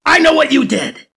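rising into a loud, high shout; building rage. Acted. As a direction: lose control word by word. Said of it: "as he calms himself down".

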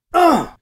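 sharp grunt from a blow to the chin; short, high, loud pained grunt. A narrator's reading. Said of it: "she grunts".

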